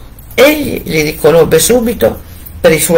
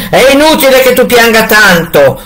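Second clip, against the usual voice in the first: cruel husband; 7 semitones higher, 4 dB louder than usual. A narrator's reading. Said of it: "le disse il marito".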